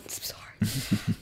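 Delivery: low, slow whispered apology; hushed mock apology; apologetic. Imitating the drunk lads' mate apologising, whispered and slow. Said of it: "going around going".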